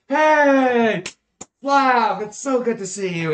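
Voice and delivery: loud, high, gushing hello; delighted greeting. Acted. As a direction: burst out greeting a long-missed friend. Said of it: "looks over and is like".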